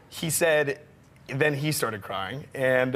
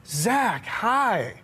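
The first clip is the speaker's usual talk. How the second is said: lightly voiced Michael, higher; 7 semitones higher, slower than usual. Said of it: "he goes".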